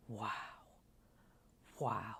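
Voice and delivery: soft, low "wow"; hushed awe, retelling his reaction; quiet amazement. Retold or imitated. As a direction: murmur it in wonder. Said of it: "the two of us were really amazed".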